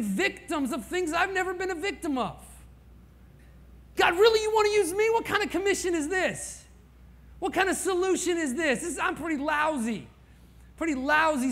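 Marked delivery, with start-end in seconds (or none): 3.9-7.7 high, loud, fast disbelieving plea; exasperated cry to God. Acted. Pastor crying out to God in disbelief, exasperated. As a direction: throw the question up at God.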